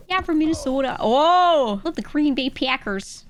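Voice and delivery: high, hammed-up Minnesota accent with long O's; mock Minnesota accent. Streamer lampooning Midwesterners, exaggerated Minnesota accent. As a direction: ham up a perky Minnesotan. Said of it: "they're all from Minnesota".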